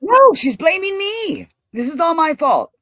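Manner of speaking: high, loud, slow indignant protest; indignant outburst. Real, not acted. Streamer vents that her friend blames her, loud and indignant. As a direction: rise up in loud disbelief, slowly. Heated rant about a former friend.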